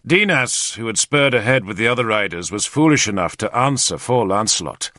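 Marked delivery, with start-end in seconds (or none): none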